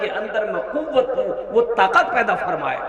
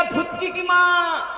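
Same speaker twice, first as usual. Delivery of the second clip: slow, higher drawn call to the wife; 8 semitones higher than usual. Retold or imitated. Sick husband calling his wife at night, slow high drawn call. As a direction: stretch it out to reach the next room. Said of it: "बीवी को आवाज दीजिएगा".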